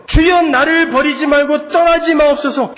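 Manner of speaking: high, shouted plea; crying out in prayer. Acted. Preacher voices David begging God to stay, crying out. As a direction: cry out to the Lord, pleading. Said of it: "그게 다윗의 기도예요".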